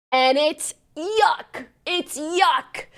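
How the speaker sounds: high, drawn-out disgust; exclaimed disgust. Real, not acted. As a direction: spit out YUCK with relish. Disgusted verdict on the couple.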